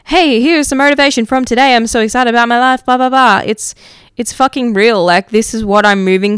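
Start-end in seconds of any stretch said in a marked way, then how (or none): 0.0-3.4 higher, louder, faster mock-cheery podcast intro; hammed-up bubbly enthusiasm, poking fun at forced positivity; mock upbeat host. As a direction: parody a relentlessly upbeat motivational host. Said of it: "not every episode is going to be like".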